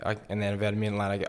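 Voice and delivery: ordinary talk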